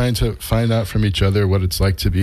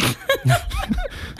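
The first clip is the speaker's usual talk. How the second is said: high burst of laughter; 4 dB quieter than usual. Real, not acted. Laughs at homonym question.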